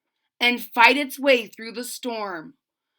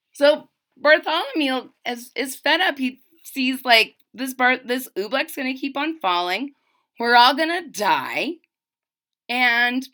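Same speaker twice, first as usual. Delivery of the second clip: usual speaking voice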